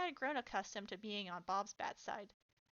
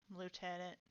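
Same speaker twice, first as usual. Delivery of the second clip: low, soft, drained voice; tired, subdued acknowledgement; 5 semitones lower, 5 dB quieter than usual. A narrator's reading. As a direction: say it wearily, with the energy gone. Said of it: "in a drained voice".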